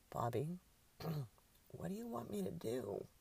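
annoyed. A narrator's reading. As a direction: choke it out, fed up. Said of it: "choke out an annoyed".

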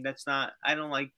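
usual speaking voice

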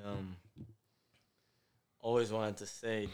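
usual speaking voice

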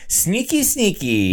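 high, sly sing-song sneaky; imitating a sneaky butler; sneaky whisper-like impression. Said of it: "constantly sneaking up and going".

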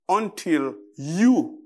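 ordinary delivery

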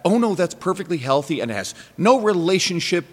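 high-pitched, dismissive mimicry of a false claim; mock-reassuring, pitched well up; mocking deniers. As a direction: parrot the excuse up high with exaggerated certainty. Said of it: "people out there spreading this, this fucking lie".

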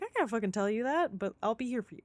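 quiet. Retold or imitated. Voices Nadia comforting.

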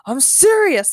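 high, loud protest; insistent. A narrator's reading. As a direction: insist on being believed. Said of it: "said Ben".

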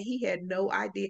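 ordinary talk

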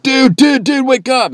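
very high, loud shout; urgent wake-up call. Retold or imitated. Friend shouting him awake, very high and loud. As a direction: yell to shake a sleeper awake. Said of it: "if it's like".

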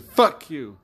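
acted-out indignant curse; retold angry retort. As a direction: fire it back, imitating an angry father.

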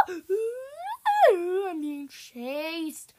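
high loud cry stretching into a slow wail; panicked wail. Real, not acted. Gamer being chased in a maze, panicked wail. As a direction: wail it out as you flee.

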